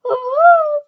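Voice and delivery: loud high horrified shriek; Aunt Petunia shrieking; shriek of horror. A narrator's reading. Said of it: "exclamation d'horreur".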